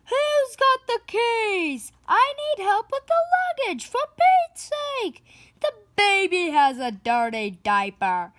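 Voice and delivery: high, loud, harried shout; frazzled yelling. Acted. Parent yelling at the family over the luggage. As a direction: holler over the chaos, fed up.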